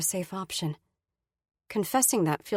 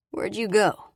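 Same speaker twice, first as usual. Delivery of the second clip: casual; 2 semitones higher, 3 dB louder, slower than usual. Acted. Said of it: "His tone is casual".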